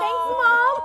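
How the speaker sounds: high, loud mock-grateful cry; acted thanks to mom. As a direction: play up thanking your mother on air. Retells thanking mother's fake call.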